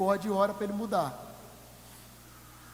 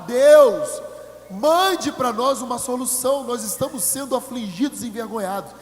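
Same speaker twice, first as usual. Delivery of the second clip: crying out to God; 2 semitones higher, 8 dB louder than usual. Retold or imitated. Congregation crying out to God, loud pleading. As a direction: beg God loudly for help.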